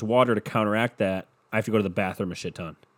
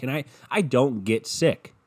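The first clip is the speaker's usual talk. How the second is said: ordinary talk, nothing marked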